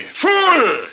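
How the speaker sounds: high, loud scornful cry; angry rebuke. Acted. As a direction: lash out at the idiot. Chief rebukes a careless member.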